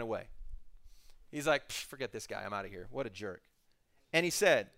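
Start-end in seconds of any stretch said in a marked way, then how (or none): 1.7-3.4 scornful mutter. Retold or imitated. Naaman muttering off.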